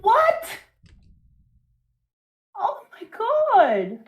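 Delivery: high, laughing outrage; shocked laugh. Real, not acted. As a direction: gasp and laugh in disbelief.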